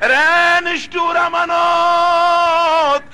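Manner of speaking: very long, high chanted wail; desperate call. Acted. Munsif runs after Amanat begging, high wailing shout. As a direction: holler after him, stretching the name.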